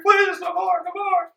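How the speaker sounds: high, loud pleading wail of a beaten man; beaten officer begging. Acted. Beaten British officer begging the rogue, high pleading cry. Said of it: "He's like".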